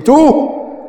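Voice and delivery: high, loud, slow accusation; King Ahab confronting the prophet, high and loud; accusing king. Retold or imitated. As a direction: throw the question at him in outrage.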